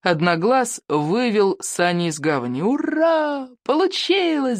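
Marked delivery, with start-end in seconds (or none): 2.6-4.6 high, thrilled cheer; joyful yell. A narrator's reading. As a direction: scream with triumph. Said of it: "завопила Камикадзе". (радостный вопль)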